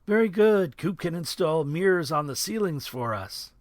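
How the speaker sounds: teasing Coop with a wink; hammed-up sarcastic buddy; mocking jab. A narrator's reading.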